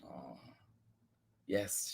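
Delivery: plain reading voice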